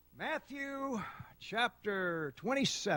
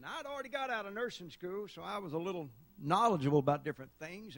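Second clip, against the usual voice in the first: usual speaking voice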